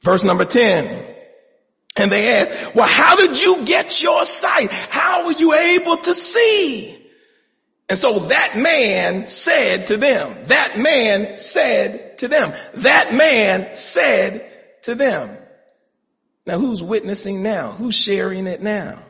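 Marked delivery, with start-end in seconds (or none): 2.7-7.0 higher, louder crowd asking how; loud, high demanding question. Acted. People question the healed man. 7.9-15.4 drawn out, each word weighed; slow it down and lean on it; preacher stretching the line for effect; slow emphatic repetition. Real, not acted.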